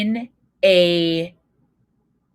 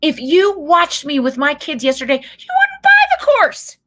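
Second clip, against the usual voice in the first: normal talking voice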